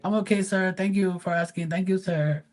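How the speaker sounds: high, soft and deferential; polite pool guy voice. Retold or imitated. Pool guy thanking the homeowner, high and humble. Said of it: "He's like".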